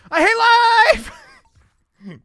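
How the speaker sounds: high, drawn-out scream of exasperation; frustrated scream. Real, not acted. Streamer failing at a Mario level, screamed in frustration. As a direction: scream it out at the top of your voice.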